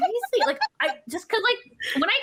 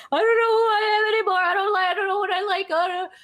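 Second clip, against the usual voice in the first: mock despair; 8 semitones higher, 3 dB louder than usual. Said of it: "I'm just like".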